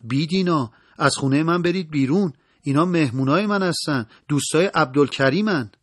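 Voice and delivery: defiant acted yell; narrated shout. A narrator's reading. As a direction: shout the intruders out of your house. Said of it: "فریاد زد".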